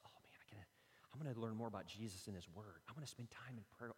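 hushed excitement. Retold or imitated. Excited whisper of devotion.

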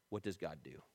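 soft, low question; gentle reminder. Acted. Fellow leader to a discouraged friend, quietly reminding. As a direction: drop right down to a quiet prompt.

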